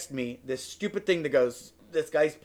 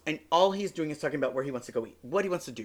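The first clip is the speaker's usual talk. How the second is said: usual speaking voice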